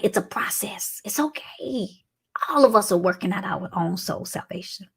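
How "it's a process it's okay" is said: soft unvoiced comfort; whispered reassurance. Real, not acted. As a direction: breathe it gently to them.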